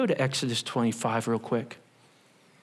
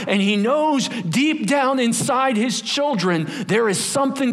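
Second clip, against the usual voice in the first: raised, higher preaching voice; 9 semitones higher, 4 dB louder than usual. Acted. Pastor preaching hard to his congregation, fired up. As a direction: preach it out with fire.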